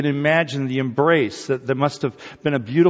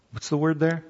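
ordinary delivery

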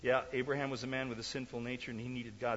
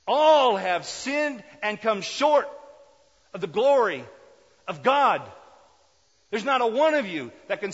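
preaching at full force; 12 semitones higher, 18 dB louder than usual. Acted. Preacher quotes scripture loudly.

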